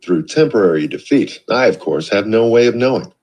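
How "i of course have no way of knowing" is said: plain reading voice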